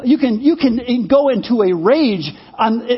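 usual speaking voice

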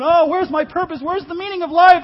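high, loud mock-anguished wail; mock desperation. Preacher mimicking people running in circles, mock desperation.

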